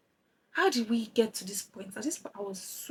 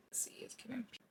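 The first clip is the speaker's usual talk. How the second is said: whispering; 3 semitones higher, 17 dB quieter than usual. Real, not acted. Trying blush colors, whispered aside.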